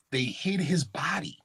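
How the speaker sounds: whisper. Acted. Whispered secret in lecture.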